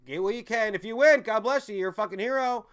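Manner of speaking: loud, high rallying yell; fired-up shout. Real, not acted. Streamer yelling to volunteer fighters, fired up. Ranting about volunteers for Ukraine.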